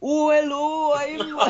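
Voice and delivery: high, lilting Welsh newsreader; breaking into laughter in Welsh accent. Acted. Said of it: "it has to be a Welsh newsreader".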